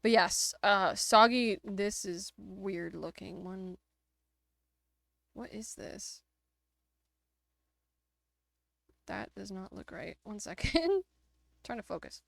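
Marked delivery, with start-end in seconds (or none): 1.6-12.2 gravelly, congested voice; gravelly from sinus drainage. Real, not acted. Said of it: "if my voice sounds a little gravelly".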